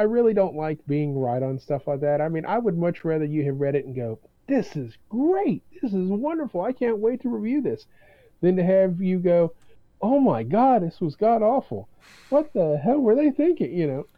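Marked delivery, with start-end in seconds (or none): 4.5-7.8 delighted. Retold or imitated. Imagined delighted reviewer. 10.0-13.7 higher, louder mock disgust; appalled. Imagined appalled reviewer.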